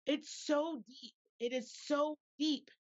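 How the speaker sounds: heartfelt slow insistence; slow emphasis. Real, not acted. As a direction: lean on it slowly.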